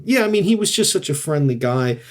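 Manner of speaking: ordinary talk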